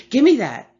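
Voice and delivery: loud furious snap; angry shout. Acted. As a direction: yell it and grab. Grabbing the cake.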